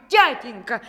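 high, loud calling voice; children calling out. A narrator's reading. Sons shouting after their father. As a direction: call out after a runaway father.

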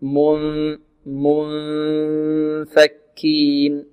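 slow منفكين with a long hum before the f; nasal hidden N. Shown as a demonstration. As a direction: hold the nasal sound in من, then release into فكين. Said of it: "مع غنة في الخيشوم".

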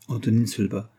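ordinary delivery

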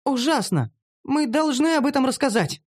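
high, urgent female character voice; high-pitched outraged urging; alarmed exclamation. Acted. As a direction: blurt it out indignantly. Gnome exclaims in horror.

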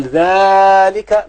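ذلك with a very long, high, loud first vowel; drawn-out far demonstrative. Shown as a demonstration. Teacher showing the word for far things, ذلك stretched high. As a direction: stretch ذلك loudly as dhaaa-lika. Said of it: "لو جاب اللام قال".

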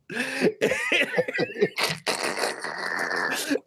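high, soft giggling; real laughter. Real, not acted. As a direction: let the laugh bubble out. Friend laughing at the scene.

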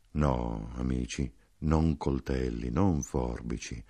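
basso, lento e sommesso; dolce, profondo. A narrator's reading. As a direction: parla piano e grave, con dolcezza. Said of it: "dolcemente, profondamente disse". (dolcezza profonda e lenta)

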